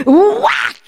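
high, sudden swat sound; exclaimed whack. Retold or imitated. Principal swats her off the floor, explosive whack. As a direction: burst out like a paddle landing. Acts out the paddle blow.

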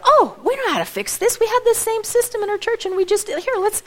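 high-pitched, eager can-do quote; imitating confident helpers, pitched well up; retold eager helpers. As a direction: jump in brightly, voicing helpers who know the fix. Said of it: "And they go".

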